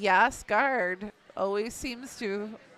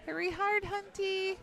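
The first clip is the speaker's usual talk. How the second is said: higher, pitched-up sweep call; curling skip yelling; 10 semitones higher, 5 dB quieter than usual. Real, not acted.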